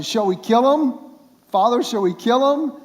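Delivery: high, loud eager asking, the king keen to strike. Acted. King eager to kill captives.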